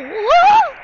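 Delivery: high, loud "whoa"; startled yell. Real, not acted. Player asking others to move, startled yell. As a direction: yelp in sudden surprise.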